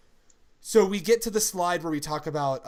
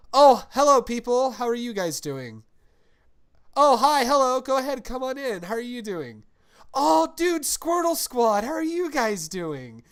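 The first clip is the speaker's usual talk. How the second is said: high, loud bubbly welcome voice, much higher and louder; May greeting fans; 9 semitones higher, 8 dB louder than usual. Retold or imitated. Imitating cohost welcoming panel guests, high loud gushing. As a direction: gush hello like an excited host greeting guests. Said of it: "you hear May go".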